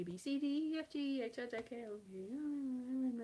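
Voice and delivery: usual speaking voice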